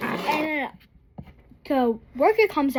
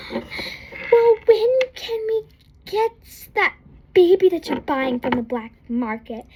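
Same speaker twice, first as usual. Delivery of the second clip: mock innocent kid; 6 semitones higher, 3 dB louder than usual. Girl asking, retold in high voice.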